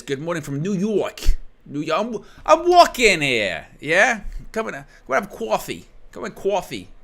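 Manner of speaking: hammed-up, high-pitched New York accent; exaggerated New York street accent, playfully bad. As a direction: put on a cartoonish New Yorker. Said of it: "that's my New York accent".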